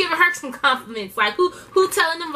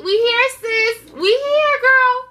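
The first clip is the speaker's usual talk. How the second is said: excited call-out; 11 semitones higher, 5 dB louder, slower than usual. Real, not acted. Hyping the audience at the start.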